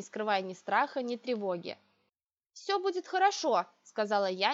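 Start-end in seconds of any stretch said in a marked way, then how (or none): 2.5-3.6 unconvinced reassurance. A narrator's reading. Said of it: "не веря собственному утверждению".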